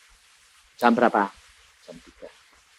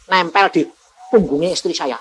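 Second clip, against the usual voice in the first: usual speaking voice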